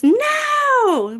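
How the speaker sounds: high, loud shouted no; big crazy shout. Shown as a demonstration. Teacher showing kids how big text sounds, high loud shout. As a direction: yell it like the giant letters on the page. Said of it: "I'm gonna read it in a big, crazy voice".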